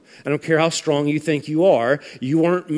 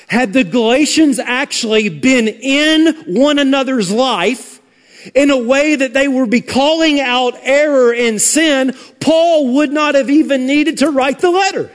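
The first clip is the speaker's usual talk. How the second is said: raised-pitch, insistent pulpit delivery; forceful preaching; 8 semitones higher, 5 dB louder than usual. Real, not acted. Preacher faulting the Galatians for neglecting one another, forceful preaching. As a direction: press the point hard, voice lifted high.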